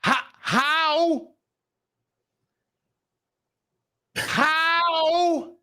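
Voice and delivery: anguished mock howl of how; disbelieving cry. Real, not acted. Outraged at physics error.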